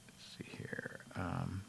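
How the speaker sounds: barely audible whisper while searching; whispering to himself. Real, not acted. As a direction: drop to a near-silent whisper, reading the screen. Muttering while searching something up.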